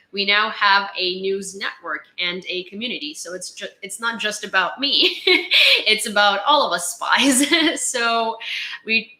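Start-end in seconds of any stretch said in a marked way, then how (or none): 5.3-5.8 a quick very high laugh; high giggle. Real, not acted. Joking with viewers about all being spies, a high giggle. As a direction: giggle at your own joke.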